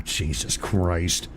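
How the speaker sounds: low, slow groan of disgust; low drawn-out groan after farting. Real, not acted. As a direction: groan at the smell. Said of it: "I farted".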